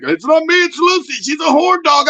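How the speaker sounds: high, loud mock protest; imitating the husband. Husband shouting that it's her fault, high and loud. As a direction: squeal it out as the guy blaming her. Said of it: "that guy would be like".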